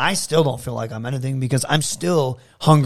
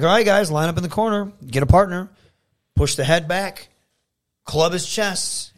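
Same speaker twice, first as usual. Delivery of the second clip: raised, higher-pitched coaching commands; trainer calling drills; 6 semitones higher, 3 dB louder than usual. Retold or imitated. Trainer to students lining up for strikes, commanding. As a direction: call out each step to the class, spaced out.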